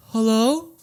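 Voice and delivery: high, tentative hello into the dark; wary calling out. Retold or imitated. Man in a dark basement, wary high call. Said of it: "it's dark and he sees something shady".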